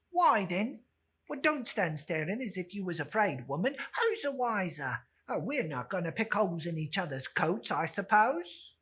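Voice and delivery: loud, brassy woman daring them; defiant charwoman. Acted. Charwoman justifying the theft to the others, loud and bold. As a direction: throw your defiance at them.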